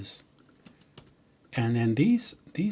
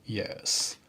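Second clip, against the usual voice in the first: whispered yes; 2 semitones lower, 5 dB quieter than usual. Retold or imitated. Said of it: "the C E O is going".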